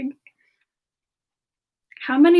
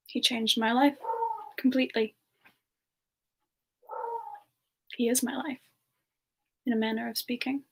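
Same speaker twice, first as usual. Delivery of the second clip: tender and soft; 7 dB quieter than usual. Real, not acted. Streamer telling chat how much she loves Xander, tender and soft. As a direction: speak of him lovingly and quietly. Tender answer about her love.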